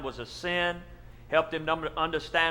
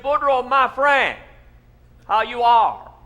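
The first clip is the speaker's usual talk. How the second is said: hearty preacher greeting; 5 semitones higher, 6 dB louder than usual. Acted. Pastor greets Boudreaux heartily.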